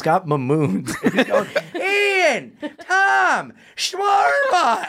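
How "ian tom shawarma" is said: high, drawn-out shout across the street; hollering names down the street, amused; mock calling out. Sam calling friends down the street, high drawn-out shout.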